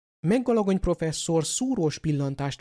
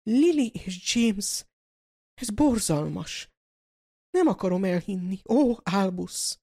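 shocked cry; 4 semitones higher than usual. A narrator's reading. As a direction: gasp out the names in horror. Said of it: "döbbenten hőkölt hátra".

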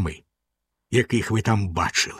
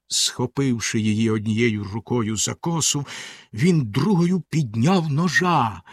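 ordinary delivery, nothing marked

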